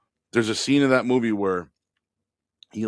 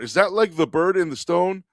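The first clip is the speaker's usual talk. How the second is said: dim coworker quote; 9 semitones higher, 5 dB louder than usual. Retold or imitated. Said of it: "That's what he said".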